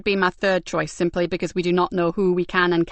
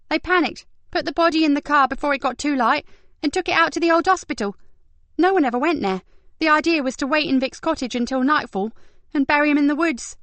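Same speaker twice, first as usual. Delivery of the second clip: put-on lightly higher young woman voice; brisk higher detective voice; Kat voice; 8 semitones higher than usual. A narrator's reading. Kat explaining the case.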